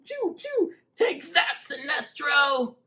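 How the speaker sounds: very high pretend-play kid voice; little kid voice. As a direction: play a kid shooting power rings at the villain. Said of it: "that's my little kid voice trying to be Green Lantern".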